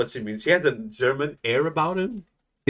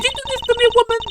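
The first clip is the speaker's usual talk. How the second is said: high squeal; 4 dB louder, faster than usual. Acted. Passenger voice squeal.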